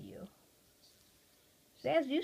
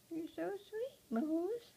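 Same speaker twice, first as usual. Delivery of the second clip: pet talk; 9 semitones higher, slower than usual. Real, not acted. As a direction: coo it tenderly. Cooing to pet bird.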